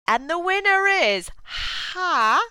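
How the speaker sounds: high, drawn-out suspense build; winner announcement. Retold or imitated. Announcer reading out the first place winner, suspenseful. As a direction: imitate an announcer building to the name. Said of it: "So they were like".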